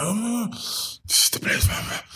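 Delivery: hushed, breathless; awed whisper. Retold or imitated. To his partner on finding the factory, an awed whisper. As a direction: whisper it like you found treasure.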